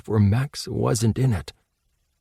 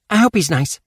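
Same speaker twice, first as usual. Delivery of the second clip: put-on young girl's hopeful thought, pitched up; Casey hopeful; 8 semitones higher, 4 dB louder than usual.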